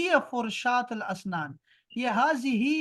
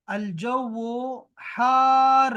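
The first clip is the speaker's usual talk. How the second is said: "الجو حار" very slow, "حار" long and loud; slow model pronunciation; 2 semitones higher, 5 dB louder, much slower than usual. Shown as a demonstration. Teacher modelling the word for hot, slow and emphatic. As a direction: hold the vowel of "حار" in a long steady stretch.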